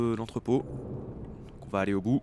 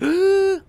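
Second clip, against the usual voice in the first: loud high startled gasp; sharp gasp; 12 dB louder than usual. Real, not acted. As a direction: gasp in sudden fright. Guard suddenly appears.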